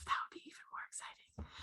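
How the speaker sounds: whispered aside. Real, not acted. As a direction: drop to a whisper. Whispered aside about subscriber goal.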